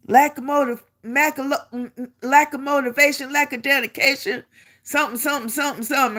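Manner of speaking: high-pitched mimicked reprimand; imitated sergeant. Drill sergeant reciting write-up charges, imitated reprimand. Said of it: "I got to get it like he said".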